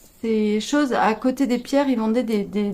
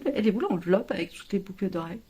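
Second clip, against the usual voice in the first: whispered question; 4 semitones lower, 8 dB quieter, faster than usual. Retold or imitated. Asking partner where earrings went.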